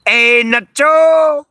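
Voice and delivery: long drawn-out high call, loud and slow. Real, not acted. Owner calling the dog Nacho from outside, drawn-out high call. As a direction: call across the yard, stretching the name out.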